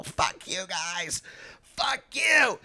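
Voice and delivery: slow, high, held-back scream; imitated distant screaming. Drunk comic screaming at hecklers, imitated scream. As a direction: scream it small, dragging the words. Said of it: "to the point to where he started screaming".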